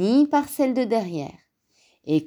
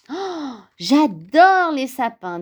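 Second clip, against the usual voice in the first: gasp, then higher, drawn-out delight; grinning delight; 6 semitones higher, 4 dB louder, slower than usual. A narrator's reading. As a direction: gush with a huge smile. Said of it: "avec un sourire jusqu'aux oreilles". (ravi, sourire jusqu'aux oreilles)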